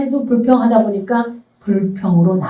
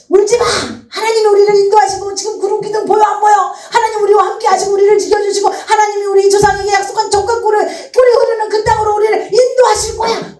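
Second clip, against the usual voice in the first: much higher, firm rallying call; rousing encouragement; 11 semitones higher than usual. Acted. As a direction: lift the people up, urge them on. What Moses should have said.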